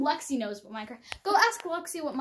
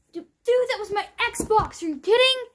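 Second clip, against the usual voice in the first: high, loud protest; outraged yelling; 8 semitones higher, 8 dB louder than usual. Acted. Owner protests over the Xbox, outraged yelling. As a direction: yell in disbelief, pitch way up.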